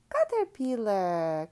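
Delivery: usual speaking voice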